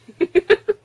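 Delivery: rising squeal of laughter; high laugh. Real, not acted. Laughing at own story.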